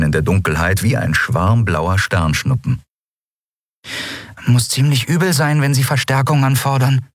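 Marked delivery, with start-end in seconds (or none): 4.5-7.1 high little creature, musing; tiny high-pitched remark; murmuring Nelen. A narrator's reading. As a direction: muse to yourself in a small high voice. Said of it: "murmelte sie".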